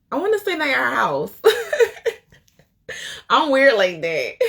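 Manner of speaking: gushing, high-pitched, breaking into laughter; laughing confession. Real, not acted. Host admitting fandom of an author, real laughing. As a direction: laugh at your own fangirling.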